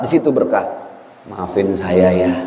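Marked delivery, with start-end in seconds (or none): 1.3-2.3 humble apology. Retold or imitated. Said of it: "datangi, Pak".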